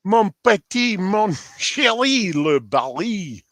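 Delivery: high, drawn-out mock French; hammed-up, badly faked French accent with stretched vowels; murdered French accent. Greeting a friend who just came in, murdered French accent. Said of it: "Excuse my murdering of a French accent".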